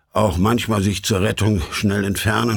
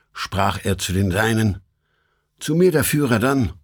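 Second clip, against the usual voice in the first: ordinary delivery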